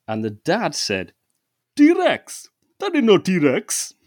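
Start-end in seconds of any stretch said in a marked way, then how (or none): 1.7-3.9 put-on loud, high, incredulous Indian-accented dad. Indian dad doubts his kid about the T-Rex, in loud Indian accent. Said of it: "It's just the accent".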